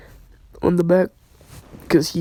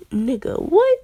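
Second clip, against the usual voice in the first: incredulous, voice leaping much higher; startled disbelief; 9 semitones higher than usual. Retold or imitated. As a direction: imitate a startled double take, pitched way up. Acted startled reply in story.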